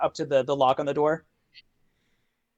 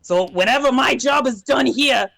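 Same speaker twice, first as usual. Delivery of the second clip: resigned imp; 3 semitones higher than usual. Acted. Imp explaining his life in the box, resigned. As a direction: shrug it off as a put-upon imp.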